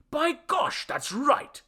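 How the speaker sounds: high-pitched burst of surprise; suddenly high, amazed realization; surprised. A narrator's reading. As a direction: exclaim it as if it just struck you.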